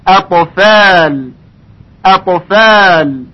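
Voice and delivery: اطفال with a light hamza, drawn out; slow recitation. Shown as a demonstration. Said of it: "ترقیقش کاملاً رعایت بشه".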